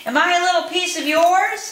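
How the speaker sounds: small Pezzettino shouting his question up the mountain; hopeful shout. A narrator's reading. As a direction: call up high and loud, hoping. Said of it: "shouted Pezzettino".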